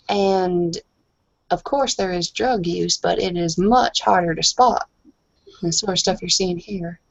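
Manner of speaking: usual speaking voice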